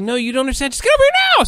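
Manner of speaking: very high, climbing squeal of command; imitated frantic urgency. Imitating scientist friend demanding she come over now, frantic. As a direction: cut off questions, rising to frantic.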